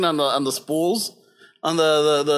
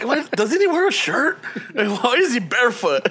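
incredulous; 5 semitones higher than usual. Retold or imitated. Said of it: "I'm like".

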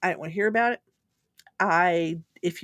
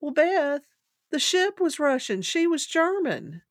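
mock patient Daddy; 8 semitones higher, 3 dB louder, slower than usual. Father delivers the punchline.

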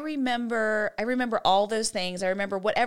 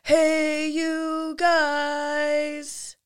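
Sloth's shout, drawn out; lightly voiced Goonies call; movie quote; 6 semitones higher, much slower than usual. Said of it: "he says".

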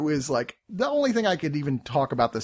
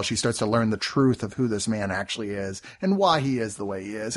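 ordinary talk, nothing marked

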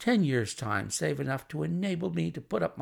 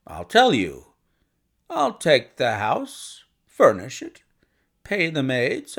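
bustling visitor; 4 dB louder than usual. Acted. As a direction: make a bluff, confident proposition. Narrator voices the visitor Blessington.

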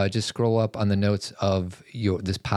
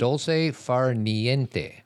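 slow, careful Italian-sounding phrase; a touch of put-on Italian, drawn out; Italian accent; 4 semitones higher, much slower than usual. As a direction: lean into the Italian pronunciation. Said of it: "you're kinda sounding like you're trying to do your Italian accent".

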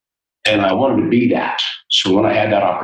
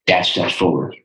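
ordinary talk

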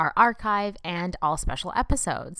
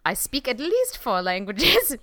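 bad German accent; 6 semitones higher, 5 dB louder than usual. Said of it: "not this one I'm doing right now".

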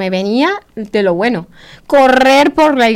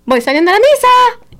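chirpy announcement; 12 semitones higher, 4 dB louder than usual. Retold or imitated. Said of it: "yo les mandaba una fotico".

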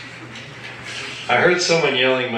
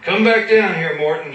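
much higher, much louder call down the hill; shouting; 7 semitones higher, 3 dB louder than usual. A narrator's reading. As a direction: yell at the fleeing artist to come back. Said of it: "shouted Todd".

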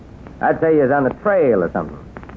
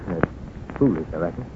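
quiet; 8 semitones lower, 8 dB quieter, slower than usual. Acted. Grieving widower, confessing softly.

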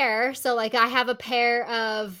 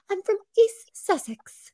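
put-on slow, high, prim British accent of a little girl; imitating a six-year-old's British accent, raised pitch; British child accent; 10 semitones higher, slower than usual. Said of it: "she has this great British accent".

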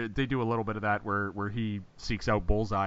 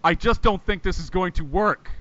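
higher, louder mock-dramatic line reading; telegraphed line; 7 semitones higher, 5 dB louder than usual. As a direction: spell out the line with exaggerated portent. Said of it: "the line ... was so telegraphed".

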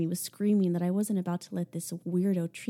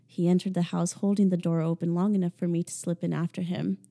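ordinary delivery, nothing marked

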